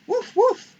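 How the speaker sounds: high, loud yappy puppy woofs; puppy bark. Acted. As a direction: bark like a small eager puppy. Puppy barks.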